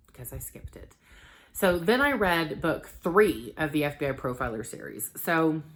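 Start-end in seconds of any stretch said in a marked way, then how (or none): 0.1-1.0 sheepish whispered confession; whispered aside. Real, not acted. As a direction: drop to a secret whisper. Sheepish aside about skipping book.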